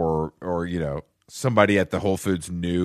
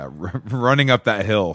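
usual speaking voice

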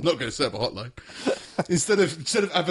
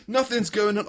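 guilty dog feigning innocence; 7 semitones higher than usual. Acted. Voicing dog hiding mischief.

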